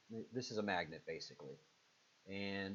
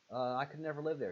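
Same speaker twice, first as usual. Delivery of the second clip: ordinary talk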